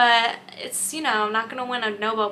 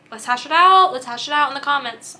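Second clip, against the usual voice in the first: playful call-out; 3 semitones higher, 6 dB louder than usual. Real, not acted. Calling viewers to debate.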